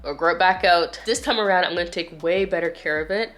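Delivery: ordinary talk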